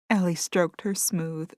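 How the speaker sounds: ordinary delivery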